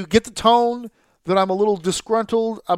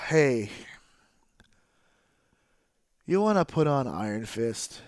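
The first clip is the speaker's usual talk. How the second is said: lifeless mock offer; 4 semitones lower, 6 dB quieter than usual. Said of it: "that death feel".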